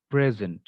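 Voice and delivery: "present" as a noun, stressed PRE-zent; stress on first syllable. Shown as a demonstration. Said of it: "the stress will be on the first part".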